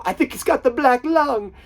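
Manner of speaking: high, loud comic outburst; mock-dramatic movie quote. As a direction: call it out high and loud like a silly movie line. Quoting brother's running joke line.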